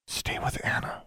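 unvoiced hushed plea; whisper. A narrator's reading. Alexandra whispers to her raven to stay. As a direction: whisper it to the bird. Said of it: "she whispered".

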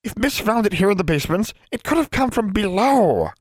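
high, worried elf voice; high-pitched anxious elf; alarmed old house elf. A narrator's reading. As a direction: warn in a high, urgent elf voice.

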